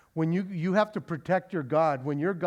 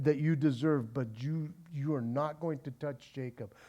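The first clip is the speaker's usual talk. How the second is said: lower, softer menacing voice; stern God warning; 4 semitones lower, 5 dB quieter than usual. Acted. God warning Laban off Jacob, low and stern.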